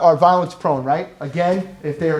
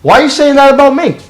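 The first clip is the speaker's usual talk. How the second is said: loud, high-pitched defensive outburst; acted offended retort, raised sharply in pitch and volume; indignant challenge; 9 semitones higher, 10 dB louder than usual. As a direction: call them out, acting affronted. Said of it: "somebody might actually call you on the carpet".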